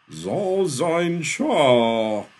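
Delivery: loud, high, stretched-out summons; booming call. A narrator's reading. Eden calls her brother from the air, booming. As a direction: draw every word out at full power.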